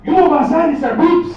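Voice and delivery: loud, high mock Mexican accent; Mexican dad accent. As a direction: shout it like a panicked dad with a thick Mexican accent. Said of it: "speaks with a wicked accent".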